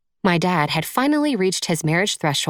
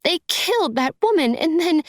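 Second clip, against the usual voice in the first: very high tearful voice; acted weepy woman, pitched way up; fresh tears; 13 semitones higher than usual. A narrator's reading. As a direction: break off high, too upset to go on. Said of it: "Tears sprang anew and spilled".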